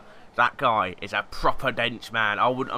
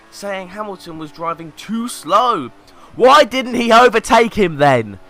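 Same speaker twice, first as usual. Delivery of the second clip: reads the tweet as a furious yell; over-the-top shouting read-out; mock angry shout; 6 semitones higher, 15 dB louder, slower than usual. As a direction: bellow it like the angry fan.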